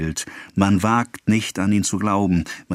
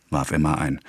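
usual speaking voice

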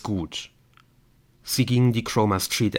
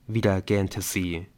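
ordinary delivery, nothing marked

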